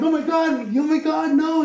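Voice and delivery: very high and loud, horrified; panicked shout. Retold or imitated. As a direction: guest losing it in shock. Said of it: "he's like".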